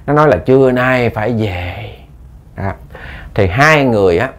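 plain reading voice

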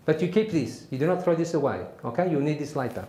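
emphatic South African military lecture; South African accent. Acted. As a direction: give step-by-step orders in a South African accent. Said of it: "try my best South African accent".

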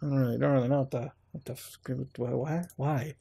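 fast, trailing off into soft confused whys; baffled mumble. Real, not acted. Stunned streamer mumbling at a weird entry. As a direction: mutter it, lost for words.

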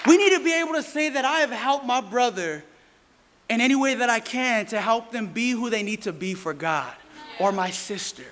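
ordinary talk, nothing marked

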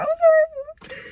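a sudden high, squeaky whine, slowed down; squeaky high wail. Real, not acted. Sick reader whining to viewers in a squeaky high voice. Still sick, groaning about it.

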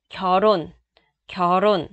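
결혼 said with R linked into the next vowel, as 겨론; linked pronunciation. Shown as a demonstration. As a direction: run the sounds of 결혼 together. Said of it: "Lưu ý cách phát âm cho mình nha".

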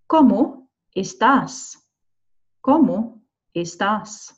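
"¿Cómo estás?" slowly, word by word; careful, separated "¿Cómo estás?" for learners to copy; slow model pronunciation. Shown as a demonstration. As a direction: pause between "Cómo" and "estás". Teacher models new phrase for repetition.

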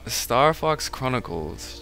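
ordinary talk

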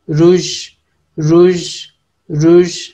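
teacher drilling रुज; Turkish word demo. Shown as a demonstration. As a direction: repeat रुज crisply for learners. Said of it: "lipstick को रुज".

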